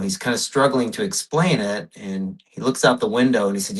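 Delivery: ordinary talk, nothing marked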